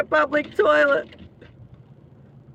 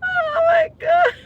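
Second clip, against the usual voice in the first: high squeal of laughter through the words; slower than usual. Real, not acted. Laughing at the dog videos with her chat, squealing. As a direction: let it break into a laugh.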